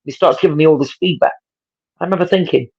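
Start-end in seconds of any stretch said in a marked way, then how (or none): none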